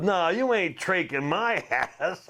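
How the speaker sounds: high, slow, laughing protest; mock panicked refusal through a laugh; laughing at his own panic; retold inner protest. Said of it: "I said to myself".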